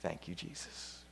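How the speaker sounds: soft, reverent whisper; hushed prayer of thanks. Real, not acted. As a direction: breathe out gratitude.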